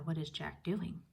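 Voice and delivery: usual speaking voice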